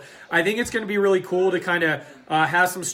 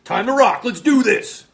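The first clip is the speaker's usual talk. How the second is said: hyped up; 5 semitones higher, 6 dB louder than usual. Real, not acted. Hyped sign-off.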